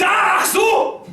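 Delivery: furious German shout. Retold or imitated. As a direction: yell it like an outraged cop. Policeman exclaims.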